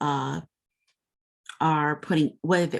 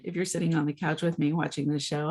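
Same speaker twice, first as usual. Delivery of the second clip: usual speaking voice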